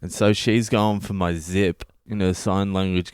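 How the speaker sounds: normal talking voice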